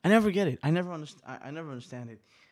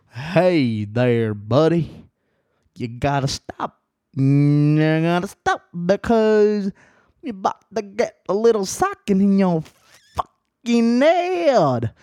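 louder, higher, slow menacing warning; mock threat; 3 semitones higher, 8 dB louder, slower than usual. To the friend who keeps insulting him, a slow mock threat. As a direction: lean in and threaten the friend, drawn out. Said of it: "to just say".

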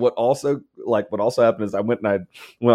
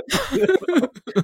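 shrieking laugh. Retold or imitated. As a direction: burst into a high shrieking laugh. Laughs after his shout.

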